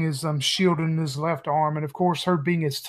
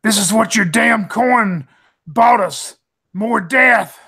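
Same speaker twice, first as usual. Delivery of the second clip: louder, higher cry flung at Red; in-character scream; 5 semitones higher, 5 dB louder, slower than usual. Acted. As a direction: yell it out slowly in anguished accusation. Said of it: "I scream at Red".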